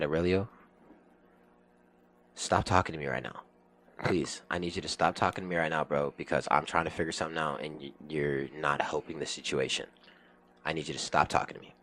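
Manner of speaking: low, soft, holding back anger; restrained warning. Retold or imitated. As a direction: quietly tell a coworker to back off. Retold warning to annoying new coworker.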